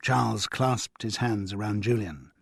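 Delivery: ordinary delivery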